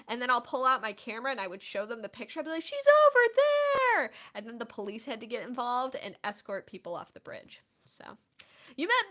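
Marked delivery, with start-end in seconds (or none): 2.6-4.1 high, loud, drawn-out yell; frantic starstruck shriek; excited shout. Retold or imitated. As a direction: scream out directions to fellow fans. Acting out pointing strangers to Oprah.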